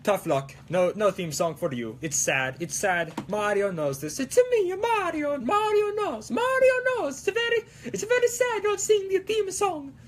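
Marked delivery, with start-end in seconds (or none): 4.2-5.3 very high-pitched cartoon Italian plumber; sky-high sing-song game mascot voice; Mario. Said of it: "a Mario impression, doing it at such a higher pitch". 5.3-9.9 very high falsetto Italian plumber; sustained sky-high game mascot voice; Mario. Pretend. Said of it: "doing it at such a higher pitch".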